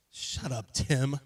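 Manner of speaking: ordinary delivery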